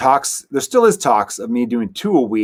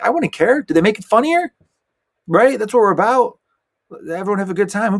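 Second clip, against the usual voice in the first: a hammed-up, much higher shrug of a voice; mock dismissive; 10 semitones higher than usual. Telling the crowd about fussy writers, a mock carefree shrug. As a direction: toss it off like who cares, pitched up. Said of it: "I'm like".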